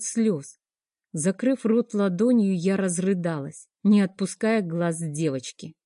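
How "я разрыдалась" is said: ordinary delivery, nothing marked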